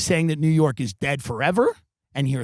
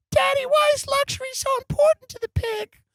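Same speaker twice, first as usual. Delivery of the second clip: put-on very high-pitched little kid voice; child's voice. Kid asking daddy about the pig, squeaky child voice. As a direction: pipe up like a puzzled child. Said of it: "the kids would go".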